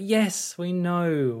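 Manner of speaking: high-pitched mock exasperation; exasperated. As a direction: wearily insist you already got it. Exasperated reply to repeated point.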